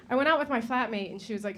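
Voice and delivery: ordinary delivery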